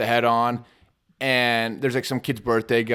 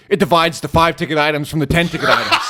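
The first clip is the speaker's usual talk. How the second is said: loud, raised-pitch exasperation; exasperated retold explanation; 5 semitones higher, 8 dB louder than usual. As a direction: spell it out to a kid, loudly and fed up. Worker explains divider to kid.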